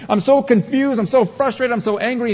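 high exasperated whine, lightly voiced; mock frustrated believer. Pastor imitating a believer upset at the news, high.

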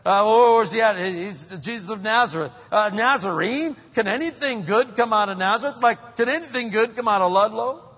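high, loud mocking disbelief; scoffing Nathanael. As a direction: scoff at the hometown. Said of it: "He says".

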